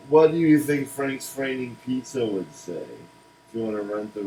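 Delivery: usual speaking voice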